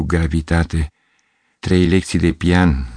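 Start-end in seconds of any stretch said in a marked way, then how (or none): none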